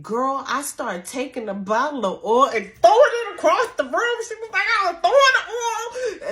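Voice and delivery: imitating the panicked mother reliving it; frantic retelling. Mother retells throwing holy oil.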